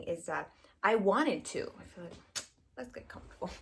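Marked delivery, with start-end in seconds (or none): 1.8-3.6 soft conspiratorial whisper, breathy and close. Acted. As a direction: lean in and whisper like sharing a secret. Playful cosy aside to viewers.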